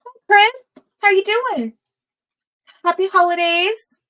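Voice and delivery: high, loud happy hello; excited greeting. Real, not acted. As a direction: greet the newcomer with delight. Greeting a viewer joining the stream.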